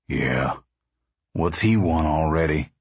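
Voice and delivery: low, wary man's voice; suspicious father. A narrator's reading. Pa asking about the camp manager, suspicious. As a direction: question it in a low, doubting voice.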